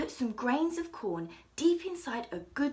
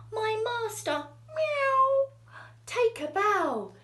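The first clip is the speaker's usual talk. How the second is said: higher, louder cat voice with a meow; proud cat; 11 semitones higher, 6 dB louder than usual. Acted. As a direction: show off your master proudly. Cat presents his master.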